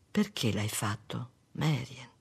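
low, soft and slow; subdued, heavy question; hushed question. A narrator's reading. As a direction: ask it quietly, voice sunk low. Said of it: "chiese lui".

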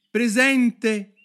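high, loud answer to a roll call; shouting. A narrator's reading. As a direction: cry out on impulse. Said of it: "gridò Alice". (grido acuto e squillante)

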